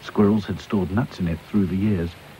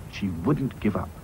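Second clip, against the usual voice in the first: ordinary delivery, nothing marked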